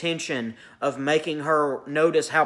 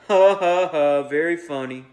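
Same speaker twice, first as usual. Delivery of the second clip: spoken laugh dripping with cynicism; cynical drawling laugh; 4 dB louder than usual. A narrator's reading. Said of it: "which was cynical and drawling at home".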